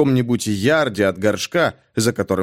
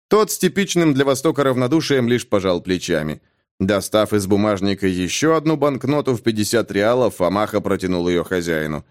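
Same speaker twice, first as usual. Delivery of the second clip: usual speaking voice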